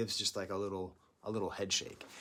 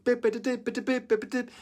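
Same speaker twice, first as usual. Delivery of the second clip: high loud imitated bleeps; synth bleeps; 11 semitones higher, 6 dB louder than usual. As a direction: mimic a vintage synth beep.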